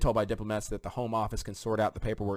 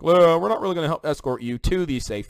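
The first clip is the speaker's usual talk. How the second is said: higher, louder mock of officials brushing people off; mocking the administration; 7 semitones higher, 7 dB louder than usual. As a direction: sneer it out as a careless official. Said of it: "They're like".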